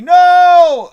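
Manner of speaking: long, loud, high-pitched cry of no; drawn-out shout. Acted. Reading out a viewer's message, long loud shout. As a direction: belt it out and hold it.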